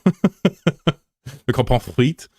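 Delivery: slow, high snooty French voice; drawn-out French accent, very slow; mock French accent. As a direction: play a prissy Frenchman correcting you. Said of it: "All right, Frenchie, calm down".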